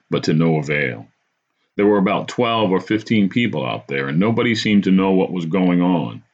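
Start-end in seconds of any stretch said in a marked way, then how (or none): none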